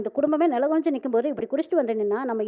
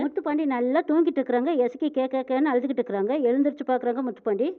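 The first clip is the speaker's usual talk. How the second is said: usual speaking voice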